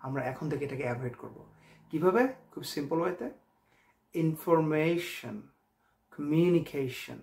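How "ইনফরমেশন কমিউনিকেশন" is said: Information, communication stretched very slowly, syllable by syllable; dramatic practice delivery. Shown as a demonstration. Teacher showing how to practise the ending, dramatically slow. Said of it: "এভাবে dramatic ওয়েতে".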